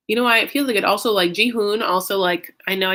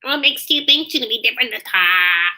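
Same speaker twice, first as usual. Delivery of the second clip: a louder, changed Debbie voice; imitating Debbie; 3 semitones higher, 5 dB louder than usual. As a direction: do Debbie asking her pointed question. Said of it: "I really did a good job mimicking her voice".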